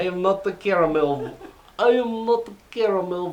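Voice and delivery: a bouncy cartoon candy mascot; caramel M&M impression. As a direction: play the caramel M&M, perky and loud. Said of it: "voiced by, uh, David".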